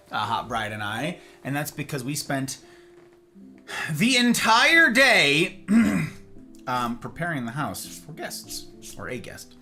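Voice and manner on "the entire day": the entire day drawn out loud; emphatic. Real, not acted.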